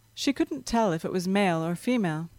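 plain reading voice

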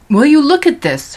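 loud, high indignant father; father's outburst. A narrator's reading. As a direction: rant at the newspaper. Father rants over newspaper.

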